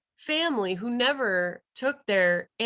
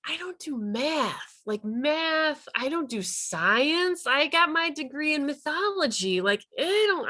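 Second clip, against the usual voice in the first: self-doubting; 7 semitones higher than usual. Retold or imitated. Self-doubting inner thought.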